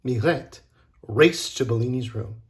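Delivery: ordinary delivery, nothing marked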